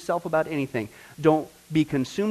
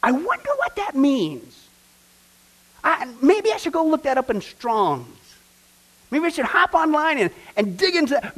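a lightly acted wondering inner voice, pitched up; curious inner voice; 8 semitones higher, 3 dB louder than usual. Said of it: "the thought went through your head".

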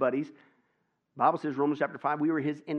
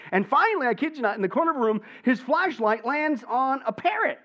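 excited build-up; 10 semitones higher, 6 dB louder than usual. A narrator's reading. Climax of joke narration.

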